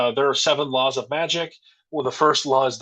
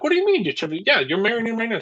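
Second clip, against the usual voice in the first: high, incredulous retold protest; lightly acted baffled objection; mock bewilderment; 9 semitones higher, faster than usual. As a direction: pitch it up in imitated confusion. Acts out family's confused protest.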